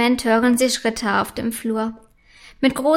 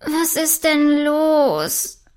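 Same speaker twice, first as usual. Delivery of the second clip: slow, drawn-out sleepy question; 3 semitones higher, much slower than usual. A narrator's reading. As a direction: ask it as if just woken. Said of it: "murmelt diese verschlafen". (verschlafen, gedehnt)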